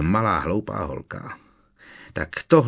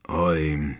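lower, rough, worn-out giant; hoarse Hagrid; 4 semitones lower than usual. A narrator's reading. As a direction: croak a tired greeting. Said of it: "odpověděl chraplavě". (chraplavý, unavený obr)

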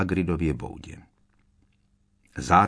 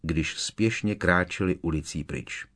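ordinary delivery

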